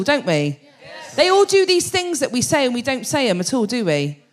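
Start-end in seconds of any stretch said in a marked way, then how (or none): none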